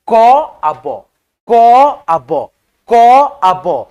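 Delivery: क आ ब drawn out slowly, letter by letter; slow sounded out. Shown as a demonstration. Teacher sounding out क आ ब slowly, letter by letter. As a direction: spell it out slowly for the class. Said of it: "यानी क में अ का ध्वनि संयुक्त है".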